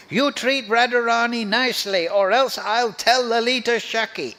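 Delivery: mock-stern telling-off, amusement close behind; chastising gopi. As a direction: scold with a teasing threat. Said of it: "they chastise Krishna".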